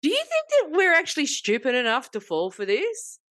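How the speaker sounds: loud, high retold telling-off; hammed-up angry rebuke; scolding the scam caller, loud and high; mock outrage. Retold yelling at scammer.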